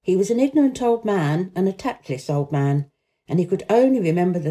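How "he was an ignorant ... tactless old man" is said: ordinary delivery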